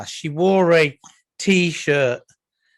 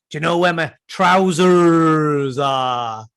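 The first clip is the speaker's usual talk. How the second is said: slow, loud, exaggerated Bristol accent; Bristolian accent; 5 dB louder, much slower than usual. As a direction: draw it right out like a mock Bristolian kid. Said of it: "She turned into a Bristolian".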